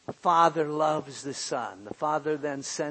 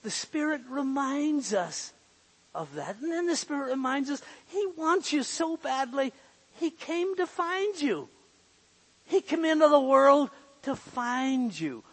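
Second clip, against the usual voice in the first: raised, pleading preacher's voice, much higher and louder; rising sermon fervour, higher pitch; fervent preaching; 9 semitones higher, 4 dB louder than usual. Acted. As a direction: press the point home to the congregation. Preacher urging God wants you.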